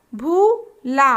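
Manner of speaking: भूला stretched very slowly; slow drawn-out word. Shown as a demonstration. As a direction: draw out भूला syllable by syllable. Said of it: "तीन वर्ण वाले शब्द".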